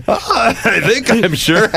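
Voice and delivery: high, drawn-out mock uncertainty; slow, squeaky send-up of unsure self, half laughing; himself in the bar. Reporter in the bar answering doubters, high hammed-up wavering. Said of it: "I'm in the bar going".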